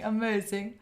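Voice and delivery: ordinary talk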